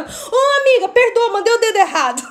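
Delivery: high-pitched mock panic apology; imitating an embarrassed plea; pleading apology. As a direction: beg a friend's pardon, hammed-up. Acts out apology to friend.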